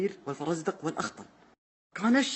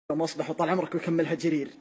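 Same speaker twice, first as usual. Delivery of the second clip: ordinary talk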